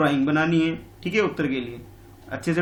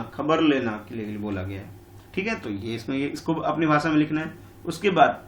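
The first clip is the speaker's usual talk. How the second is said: ordinary delivery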